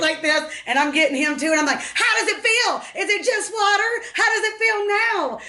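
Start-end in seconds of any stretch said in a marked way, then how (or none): 1.8-5.4 taunting. Retold or imitated. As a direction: gloat as you splash them. Taunting kids while splashing.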